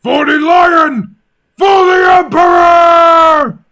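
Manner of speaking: roared war cry, high and slow; barked battle cry. Acted. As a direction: bellow it at the enemy lines. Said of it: "let me just get into character real quick".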